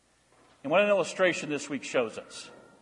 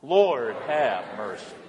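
more of a shout; 8 semitones lower, slower than usual. Shown as a demonstration. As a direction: lift it up into a shout. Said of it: "A little bit more of a shout".

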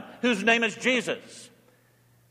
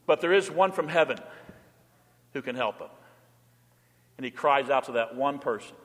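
usual speaking voice

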